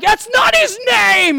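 high, loud, drawn-out yell; exasperated mock shout. Dad chasing his five-year-old around the house, hammed-up shouting. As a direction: shout it in comic outrage.

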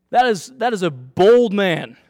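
usual speaking voice

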